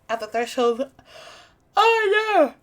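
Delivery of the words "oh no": very high, loud cry; alarmed outburst. Real, not acted. Reader interrupts the story, alarmed, high loud cry. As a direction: yell it out in sudden alarm.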